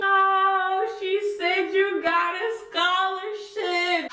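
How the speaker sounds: high, slow singsong taunt; hammed-up instigating chant from the background; egging-on friends. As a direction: gas it up in a high, drawn-out singsong. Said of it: "my friends were in the background".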